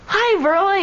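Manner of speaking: high-pitched greeting, only mildly yelled; retold friendly yell. Softball-game acquaintance yelling hello to a friend, mock yell. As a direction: imitate someone calling hello, voice lifted high.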